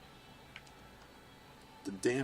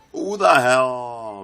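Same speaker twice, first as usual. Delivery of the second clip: high, loud yell that sags; explode, then let it fall away; outraged yell; 5 semitones higher, 15 dB louder, slower than usual. Real, not acted. Hit just before losing race.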